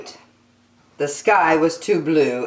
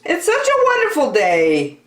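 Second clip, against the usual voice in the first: chorus; 12 semitones higher, 4 dB louder than usual. Acted. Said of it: "asked the others".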